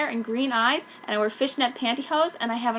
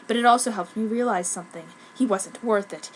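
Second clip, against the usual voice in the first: ordinary delivery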